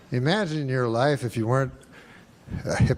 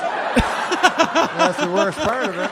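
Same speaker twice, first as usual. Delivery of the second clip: laughing; 10 semitones higher, much slower than usual. Real, not acted. Laughing through the punchline.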